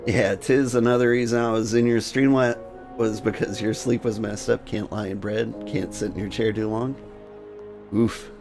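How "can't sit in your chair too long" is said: ordinary delivery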